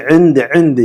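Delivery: عند begun with a throaty عین; throaty ain. Shown as a demonstration. Teacher drills the throaty عین in عند. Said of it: "عین حلق سے".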